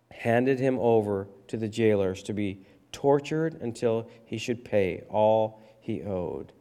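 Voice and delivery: normal talking voice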